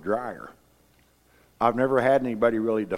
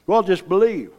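mock glib believer; 8 semitones higher, 7 dB louder than usual. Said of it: "you'll talk to people say".

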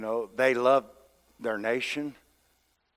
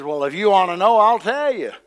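bold retort; 6 semitones higher, 9 dB louder than usual. Acted. Acted bold reply of Peter.

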